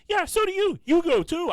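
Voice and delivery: very high, loud mock squabbling; exasperated comeback. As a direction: throw it back at her, hammed up. Retold argument with mother.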